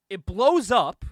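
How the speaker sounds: ordinary talk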